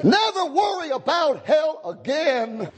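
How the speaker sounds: high, slow pulpit shout; ringing revival cry; triumphant preaching. Real, not acted. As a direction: proclaim it with long, drawn-out words.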